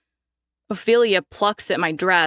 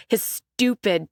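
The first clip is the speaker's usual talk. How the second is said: ordinary delivery, nothing marked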